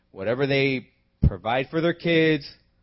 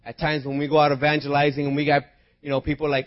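ordinary talk

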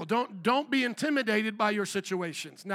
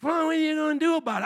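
higher, louder mock-sassy teen; 6 semitones higher, 6 dB louder, faster than usual. Kids at the park talking back, sassy. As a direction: challenge him with attitude. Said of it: "they looked at me all sassy".

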